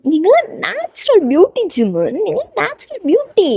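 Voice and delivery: high, lilting, adoring praise; gushing insistence. Acted. Fan insisting Jimin is a natural beauty, gushing. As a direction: swoop up high as you insist on the compliment.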